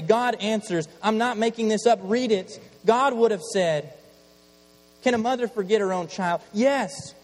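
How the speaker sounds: loud, high preacher's call; fiery preaching. Acted. Preacher tells congregation God answers, fiery preaching. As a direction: preach it out with full force.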